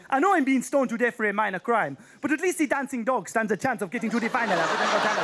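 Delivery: put-on Nigerian accent; hammed-up Nigerian woman's voice; mock Nigerian accent, earnest plea. Mimics Nigerian woman being stoned.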